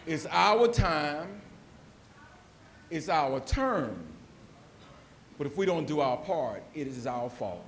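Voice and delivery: very slow, each phrase spaced out; slow emphatic motto. Shown as a demonstration. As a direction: land "our" in every line. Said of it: "I'm gonna say that again".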